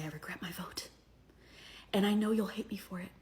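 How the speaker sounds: hushed, whispery mock confession; mock contrite. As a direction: whisper it like a penitent, mocking the confessors. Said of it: "they act so contrite".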